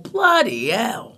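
an American's bad British accent, slow and exaggerated; mock British accent. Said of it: "what every American's default, uh, British accent".

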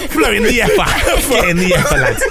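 high, loud laughing words. Real, not acted. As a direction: laugh out the phrase. Laughs at own phrase.

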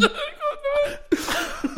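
high wheezing giggle; helpless squeaky laugh; squeaky gleeful gremlin giggle. Real, not acted. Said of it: "sound like a gremlin".

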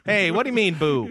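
lightly voiced hacky stand-up comic; Dave Kerry. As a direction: work the club crowd like a local comedian. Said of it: "Local comedian Dave Kerry".

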